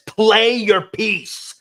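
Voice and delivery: drawn-out, high, loud yell; imitated yell. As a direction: act out a sudden outburst from a calm character. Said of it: "he yells for the first time".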